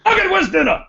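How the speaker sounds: high, loud bellowed demand; mock gruff husband. Cranky fan yells to wife for dinner. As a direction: bark for dinner like an old-school dad.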